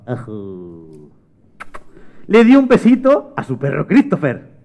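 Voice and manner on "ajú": put-on soft, low baby coo; lightly voiced baby gurgle; baby babble. Baby brother getting a kiss, mock baby babble. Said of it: "era un bebé, Pablete".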